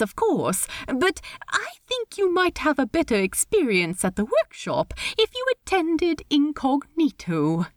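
high, lilting, polite voice; awkward host in a high register; Steed, politely awkward. Acted. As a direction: pitch it high, hesitate politely. Steed advises Ed.

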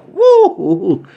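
very high woo; hyped whooping. Acted. Millionaires hyped on the plane, high whoops. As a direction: whoop like a kid.

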